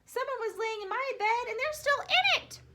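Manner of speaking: high little cub voice rising to a loud squeal; tiny Baby Bear, building. A narrator's reading. Little bear finds Goldilocks in his bed, high then loud and squealing. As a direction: start small and high, end in an excited squeal. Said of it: "And Baby Bear said".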